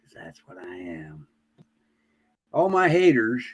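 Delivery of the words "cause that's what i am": breathy aside whisper. Real, not acted. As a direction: whisper it to yourself. Aside after his boast.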